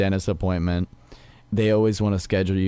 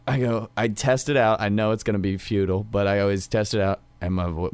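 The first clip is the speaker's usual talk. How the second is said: usual speaking voice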